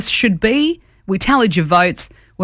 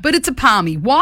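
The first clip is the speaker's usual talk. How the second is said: ordinary talk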